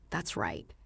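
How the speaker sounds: a quiet grandpa murmuring agreement; husband agreeing. Acted.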